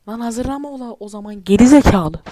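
loud annoyed shout; angry yell. Real, not acted. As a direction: snap back loudly. Yelling at clip.

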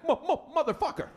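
high stammer into "motherfucker"; stutter. Retold or imitated. Comic mimics the husband recognizing his heckler, stuttering high. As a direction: imitate a stutterer cursing as he recognizes someone. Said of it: "someone has a messed up stutter".

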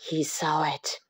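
spooky whisper. A narrator's reading.

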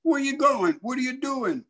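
high-pitched calling out; hollering kids. Retold or imitated. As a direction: holler after a friend who is wandering off. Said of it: "the kids hollered".

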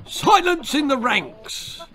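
very high, slow military yell; parade-ground outburst; drill sergeant. Acted. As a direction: screech an order across the ranks. Sergeant turkey silences the prisoner.

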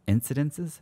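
"incidences" said with a questioning rise; unsure word check. Real, not acted. Speaker doubting his word "incidences", rising tune.

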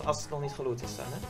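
normal talking voice

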